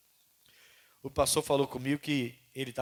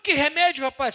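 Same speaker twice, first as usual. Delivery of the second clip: angry retort; 11 semitones higher, 9 dB louder than usual. Retold or imitated. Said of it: "um pastor meio bravo".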